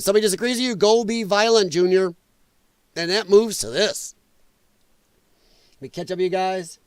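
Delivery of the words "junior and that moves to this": ordinary talk, nothing marked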